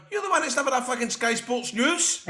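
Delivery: high-pitched mock comeback; lightly acted high retort. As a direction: imitate someone throwing it back, pitched well up. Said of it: "And they're going".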